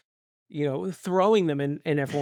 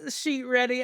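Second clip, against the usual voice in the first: high laughing apology; 10 semitones higher, slower than usual. Real, not acted. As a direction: laugh through the excuse.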